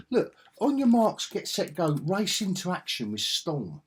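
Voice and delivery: high, punchy announcer read of a comic cover; hammed-up blurb voice; mock cover blurb. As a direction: sell it like an old comic ad.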